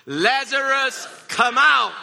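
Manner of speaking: full high-pitched commanding shout; loud cry. Acted. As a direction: shout it out as if summoning someone, drawn out. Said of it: "he cried out in a loud voice".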